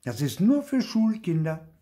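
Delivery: high-pitched know-it-all objection; contradicting. A narrator's reading. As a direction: shut the little one out, high and insistent. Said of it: "widersprach Clara".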